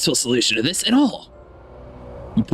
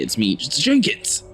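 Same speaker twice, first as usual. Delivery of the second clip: ordinary delivery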